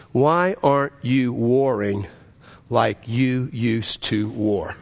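slow, low, deliberate delivery; God's solemn question. Retold or imitated. God questioning the preacher as he drives, slow and solemn. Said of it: "and God asked me a question".

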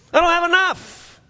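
high mock whine of lack; complaining. Preacher imitating rich and poor alike, complaining. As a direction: pitch it high like a hammed-up complaint.

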